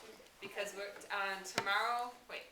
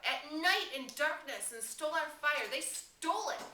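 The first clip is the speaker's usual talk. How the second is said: higher and louder, rising in anger; Ralph's voice climbing as he accuses the thieves, higher and louder; voice running up; 6 semitones higher, 4 dB louder, slower than usual. A narrator's reading. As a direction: let the voice climb with outrage. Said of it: "Ralph's voice ran up".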